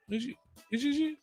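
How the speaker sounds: ordinary talk, nothing marked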